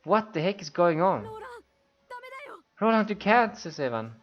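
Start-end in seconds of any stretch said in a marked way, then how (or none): 0.0-1.3 higher, louder sudden alarm; shocked outburst. Acted. Character shocked at betrayal. 2.8-3.6 much higher, louder pleading cry; desperate plea. Acted. Evan pleads with betraying friend.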